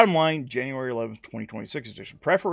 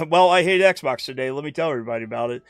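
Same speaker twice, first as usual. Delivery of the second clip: hammed-up hater yelling at the clouds, louder and higher; mock rant; 3 semitones higher, 8 dB louder than usual. Acting out an Xbox hater.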